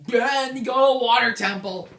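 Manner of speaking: loud, high comic yell; mock shouted order. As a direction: shout it like a daft command. Acts out the game's blunt order.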